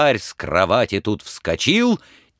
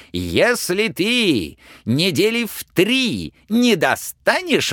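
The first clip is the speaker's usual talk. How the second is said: threatening tsar; 9 semitones higher than usual. Acted. Tsar threatens Ivan with the stake, angry. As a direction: menace him, then throw him out.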